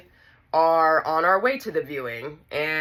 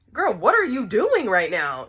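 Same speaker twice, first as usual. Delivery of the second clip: higher, exasperated telling-off; indignant scolding; 6 semitones higher, 3 dB louder than usual. Retold or imitated. Everyone reacting to the screaming sister, exasperated scolding. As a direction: call her out in disbelief.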